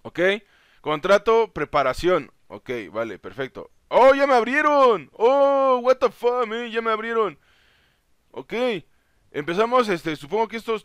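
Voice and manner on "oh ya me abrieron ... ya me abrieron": high, loud exclamation; surprised outcry. Real, not acted. Gamer sees he has been let in, surprised outcry. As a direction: cry out in astonishment, easing at the end.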